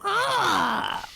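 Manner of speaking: sudden high startled cry; startled yelp. Acted. Player sneaks up on the dwarf, high startled yelp. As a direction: shriek to make the dwarf jump.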